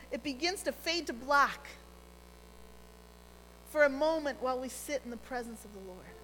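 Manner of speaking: normal talking voice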